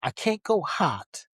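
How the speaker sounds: ordinary talk, nothing marked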